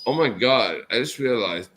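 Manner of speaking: ordinary talk, nothing marked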